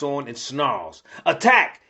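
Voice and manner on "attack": high narrated yell of command; yelled battle order. A narrator's reading. Elo orders the Warbound into battle, yelling. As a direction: call the charge, pitched up. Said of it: "yells Elo".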